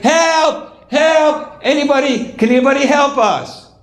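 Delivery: high, contained mock yell; acted screaming for help. Family jumping in the hole calls for help, mock screaming. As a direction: cry out high for rescue, holding the volume back. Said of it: "jumping up and down and screaming".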